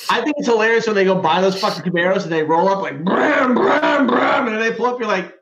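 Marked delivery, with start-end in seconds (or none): 3.0-4.5 loud high "bram, bram" like a revving muscle car; engine revving noise. Retold or imitated. Mocking Camaro drivers rolling up, loud engine revving. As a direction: rev it up with your voice, loud and high.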